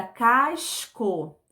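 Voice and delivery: casco said slowly, each syllable clear; slow demo word. Shown as a demonstration. Teacher slowly shows the new word casco. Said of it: "Se na primeira sílaba ... eu acrescentar o S".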